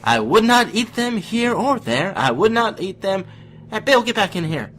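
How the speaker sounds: high silly voice. Acted. Guest switching impressions while reading to listeners, high and loud. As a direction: squeal it out bright and loud. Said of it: "it's my good friend Patrick Warburton".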